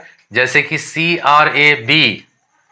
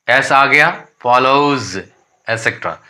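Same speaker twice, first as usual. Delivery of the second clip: ordinary delivery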